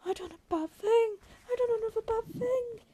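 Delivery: high-pitched, panicked dismay; frightened. A narrator's reading. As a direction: let fright push the voice right up. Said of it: "he whispered in fright".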